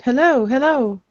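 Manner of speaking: calling out. Acted. Lily calls out to farmers a hundred feet away, calling out. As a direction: call out to someone across a field. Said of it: "Kind of calls out".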